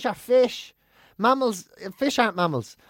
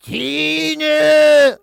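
very loud high call, then imitated cough; parrot's copy of a call and cough; parrot mimicking sick woman; 9 semitones higher, 9 dB louder than usual. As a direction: screech the name long, then hack like the invalid. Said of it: "The exact voice of the woman".

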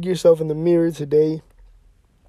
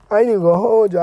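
louder, more insistent repeat; emphatic; 5 semitones higher, 9 dB louder, much faster than usual. Real, not acted. Podcaster admitting his sushi craving, emphatic. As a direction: push it harder the second time.

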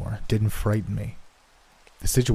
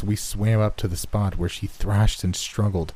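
ordinary delivery

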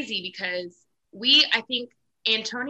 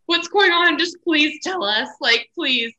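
higher, louder impatient plea, retold; pleading; 7 semitones higher, 5 dB louder, slower than usual. Contestants begging the chef to tell them, pleading. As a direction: mock-desperate begging to be told.

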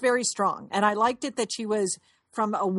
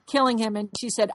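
normal talking voice

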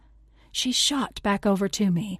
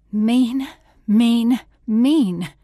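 louder, higher, each mean hammered; angry outburst; 4 semitones higher, 8 dB louder, much slower than usual. Acted. Girl venting to her friend about granny, angry and loud. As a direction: spit the word out at a cruel granny.

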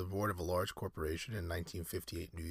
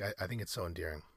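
normal talking voice

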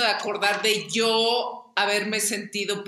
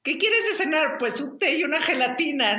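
high, giggling mock mother voice; laughing mock of mom; 6 semitones higher than usual. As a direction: tease her mom through laughter. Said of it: "mi hermana empezó a". (burla de mamá entre risas)

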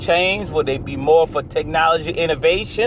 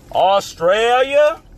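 drawn out; 6 semitones higher, much slower than usual. Real, not acted. Drawn-out country name.

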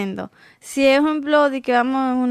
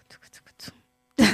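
whispered beat; faster than usual. Retold or imitated. Whispered salsa rhythm.

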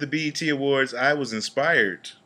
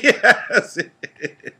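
burst of laughter; 8 semitones higher, 5 dB louder than usual. Real, not acted. Laughing at his own joke.

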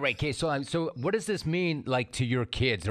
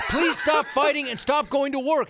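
high, loud, panicked plea; yelling at dad not to drive; frantic yelling; 12 semitones higher, 5 dB louder than usual. Retold or imitated. Retold pleading with dad.

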